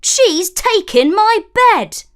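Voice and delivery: high, loud squeaky dwarf complaint; a dwarf fussing over his bed; whiny dwarf. A narrator's reading. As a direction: wail about a lost bed. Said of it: "asked a third".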